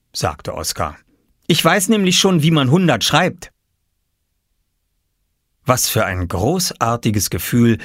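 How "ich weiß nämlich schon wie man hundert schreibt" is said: put-on high, confident child's voice; little boy. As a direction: explain it like a proud little boy. Boy explains proudly. (stolze, hohe Jungenstimme)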